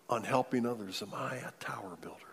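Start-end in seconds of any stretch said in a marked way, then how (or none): 1.0-2.2 whispered searching question. Acted. Preacher asking the congregation to search themselves, whispered. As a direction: drop to a whisper and ask.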